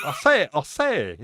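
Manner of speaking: put-on loud, high Tennessee Southern accent; blustery Tennessee Southern rooster. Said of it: "do that Tennessee Southern accent".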